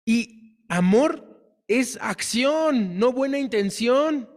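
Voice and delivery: slow emphatic maxim. Acted. Sermon climax, key maxim.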